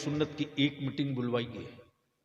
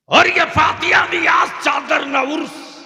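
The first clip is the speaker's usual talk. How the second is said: preaching shout; 14 semitones higher, 15 dB louder than usual. Acted. Preacher shouting to the gathering that these rites are not obligatory. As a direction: shout it, much higher and louder.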